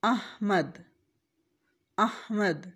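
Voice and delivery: अहमद with open a, ha and dal silent-vowelled; careful अहमद, a on alif, h held; correct Ahmad. Shown as a demonstration. Teacher modelling the correct अहमद slowly. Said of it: "मीम पर ज़बर और दाल साकिन है".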